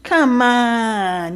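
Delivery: lightly voiced wheedling "Come on"; a hint of a pushy suitor coaxing; imitating a man trying to talk her round; mock pleading guy. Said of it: "he's like".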